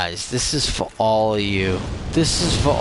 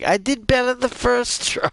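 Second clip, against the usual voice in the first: an embarrassed, pitched-up complaint; high whine; 12 semitones higher than usual. Real, not acted. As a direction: whine it out much higher. Embarrassed after dying.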